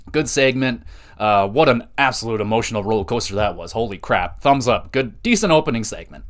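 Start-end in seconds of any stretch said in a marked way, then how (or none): none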